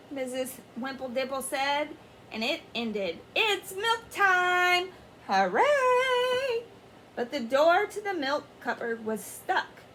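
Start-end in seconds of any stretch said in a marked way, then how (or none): 3.3-6.6 slow then high, loud cheer; excited cheer. Acted. Teacher announcing milk time, class cheering. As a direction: celebrate it.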